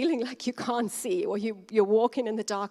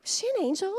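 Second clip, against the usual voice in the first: amazed wonder; 8 semitones higher, much faster than usual. Retold or imitated. Wondering aloud after nobody saw her.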